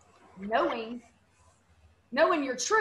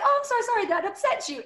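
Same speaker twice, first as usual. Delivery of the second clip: much higher, sugary mock apology; sing-song sarcastic sorry; sarcastic fake sympathy; 10 semitones higher, 4 dB louder than usual. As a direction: sweetly needle him with fake sorrow. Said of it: "really, that was my response".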